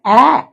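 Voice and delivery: ए said as a short open 'ae', as in cat; short, open ए for the letter A; letter sound. Shown as a demonstration. Teacher gives pupils the sound of A, short open ए. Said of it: "इसका उच्चारण होगा".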